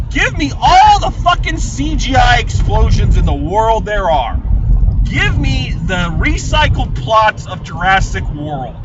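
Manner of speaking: ranting about pretentious films, loud and defiant; passionate rant. Real, not acted. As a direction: declare it proudly and loudly. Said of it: "yes, I'm gonna say it".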